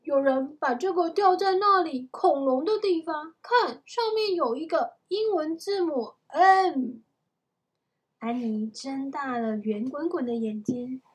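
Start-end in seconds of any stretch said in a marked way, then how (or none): 0.0-7.0 put-on high, loud excited storybook boy; excited discovery. A narrator's reading. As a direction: show off a find excitedly. Jack shows off the found medal.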